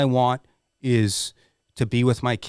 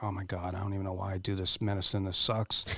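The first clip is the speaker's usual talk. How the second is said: despairing inner mutter; 4 semitones lower, 11 dB quieter, faster than usual. Retold or imitated. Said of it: "I'm like".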